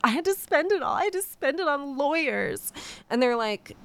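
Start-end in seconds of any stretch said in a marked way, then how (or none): 0.0-2.7 much higher, whiny imitated wail; high-pitched pleading, hammed-up; mock weeping. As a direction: protest tearfully in a put-on high voice. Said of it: "he's weeping and like".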